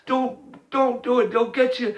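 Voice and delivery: high, urgent warning to a son; pleading ghost voice. Retold or imitated. Ghost of John warns Sean not to fight, high and pleading. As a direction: plead way up high, begging him to stop.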